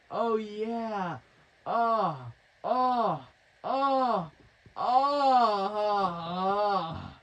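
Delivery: high, loud, hammed-up moaning. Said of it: "starts moaning".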